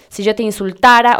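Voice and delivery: "insultara" ending in -ara, no i; correct subjunctive form. Shown as a demonstration. Teacher giving the correct form, "insultara" clearly in -ara. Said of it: "en vez de decir".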